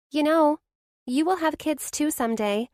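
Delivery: high, reassuring friend's voice; best friend, kind and sure; comforting Blair. A narrator's reading. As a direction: ease her friend's guilt.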